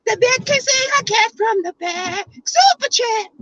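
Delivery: high, slow, silly put-on voice. Thanking a viewer for the super chat in a silly high voice. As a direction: ham it up in a squeaky goofy voice. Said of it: "thanking viewers in put-on voice".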